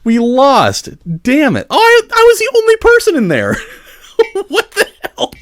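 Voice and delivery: high, loud disbelieving cry; real shock at a sudden loss; shocked outburst. Real, not acted. Loses the match unexpectedly.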